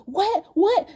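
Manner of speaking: high-pitched, rising repeated question; mock shocked husband. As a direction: imitate a husband in escalating disbelief. Imitates husband's shocked reaction.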